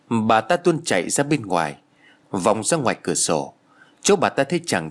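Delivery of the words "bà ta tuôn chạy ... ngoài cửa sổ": usual speaking voice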